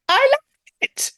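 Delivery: squeaky delighted I love it; high squeal. Real, not acted. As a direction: squeal it with glee. Gleeful squeal about Jones return.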